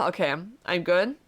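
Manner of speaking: usual speaking voice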